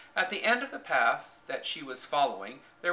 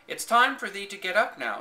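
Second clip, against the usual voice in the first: Martha in broad Yorkshire, raised and bright; a maid's broad Yorkshire call to wake the girl; broad Yorkshire accent; 7 semitones higher, 6 dB louder than usual. A narrator's reading. Housemaid waking Mary in broad Yorkshire. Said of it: "said Martha in a broad Yorkshire accent".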